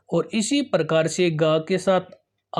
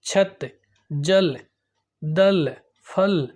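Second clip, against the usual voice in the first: slow word reading; much slower than usual. Shown as a demonstration. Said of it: "ये आप बच्चों को बुलवाए".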